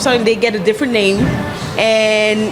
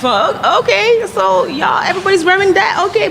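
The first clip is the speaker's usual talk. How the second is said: mock-surprised reaction; 10 semitones higher than usual. Guest mimicking her reaction to everybody wearing dashikis, mock-surprised. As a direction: react in a high voice, feigning being impressed. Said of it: "right now it's like".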